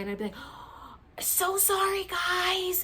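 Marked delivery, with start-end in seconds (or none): 0.4-0.9 sharp breathless gasp of embarrassment; mock gasp. Catching herself being too loud. 1.2-2.8 put-on high, loud childish "so sorry"; little kid apology. Shushed at the retreat, she apologizes like a little kid. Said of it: "I was acting like a little kid".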